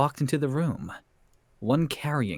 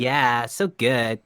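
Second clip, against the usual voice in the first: put-on slow, loud Valley Girl drawl; hammed-up Valley Girl approval; 5 dB louder, slower than usual. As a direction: stretch the words like a Valley Girl. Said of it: "a Valley Girl British voice".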